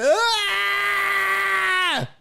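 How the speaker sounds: mocking a crybaby's yelp; mock crying yelp. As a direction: wail like the hit kid, hammed up. Imitating the kid crying out.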